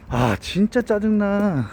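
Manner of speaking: high, slow frustrated groan; frustrated whine. Shown as a demonstration. Teacher voicing annoyance at work, frustrated. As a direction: sound fed up with the work.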